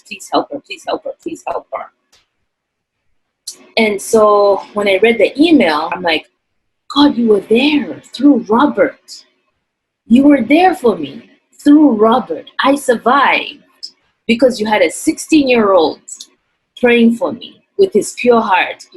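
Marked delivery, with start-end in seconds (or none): 0.0-1.9 pleading softer and softer into a whisper; fading prayer. Retold or imitated. 6.9-18.8 moved. Real, not acted. Moved by God's help.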